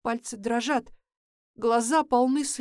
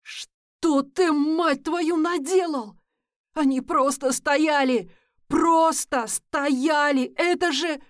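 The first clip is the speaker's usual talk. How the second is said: louder and higher, outraged; furious shout; 4 semitones higher, 5 dB louder, slower than usual. Acted. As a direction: yell at him in horror. Narrator screams at Nick over killed children.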